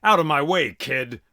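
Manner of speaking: loud barked order; commanding shout. Acted. Shiva shouting at the boy guarding the door. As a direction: bellow it at the boy. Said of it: "looked down at me and he said".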